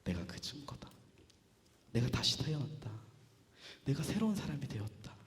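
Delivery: hushed, low declaration; quiet testimony, nearly whispered at first; solemn Paul. Acted. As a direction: quote it softly with long pauses. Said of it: "바울이 뭐라고 하냐면".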